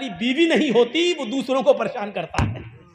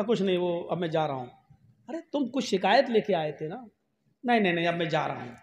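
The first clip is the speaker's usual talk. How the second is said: softer, lower, fast brush-off; sheepish mumble; 6 semitones lower, 8 dB quieter than usual. Acted. Complainant avoiding the caliph, quick and quiet. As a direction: hurry away, embarrassed. Man dejectedly leaving.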